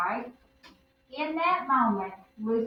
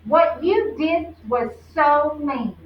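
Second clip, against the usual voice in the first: slow, louder, stress on "you"; firm accusation; 5 semitones higher, 6 dB louder, slower than usual. Acted. Lucy confronting Ralph at the monkey bars, slow and firm. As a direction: lay it on him, slow and firm. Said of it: "looked at him in the eye and said".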